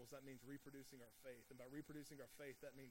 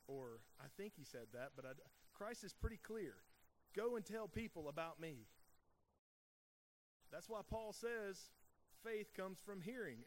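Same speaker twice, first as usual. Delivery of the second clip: louder, driving pulpit delivery; preaching at full force; 3 semitones higher, 5 dB louder than usual. Acted. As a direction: preach it out to the whole room. Impassioned preaching of the commission.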